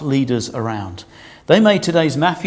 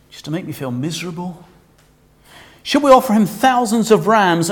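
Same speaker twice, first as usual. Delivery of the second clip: put-on higher, whiny, sarcastic stroppy teenager; teenage sarcasm; 4 semitones higher, 3 dB louder than usual. Israel answering God from the witness stand, sarcastic whine. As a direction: whinge it back like a sulky teen. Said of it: "read it in your best teenage sarcasm style".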